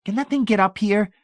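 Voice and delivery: put-on very high, loud nervous boy. A narrator's reading. David Pritchett eyes the boarded basement door, nervous. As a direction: ask nervously in a high-pitched boy's voice. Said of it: "David Pritchett asked nervously".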